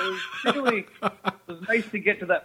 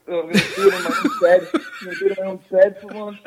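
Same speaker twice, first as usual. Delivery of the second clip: laughing; 3 semitones higher, 7 dB louder, faster than usual. Real, not acted. Laughing joke about bed.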